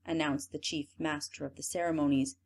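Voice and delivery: usual speaking voice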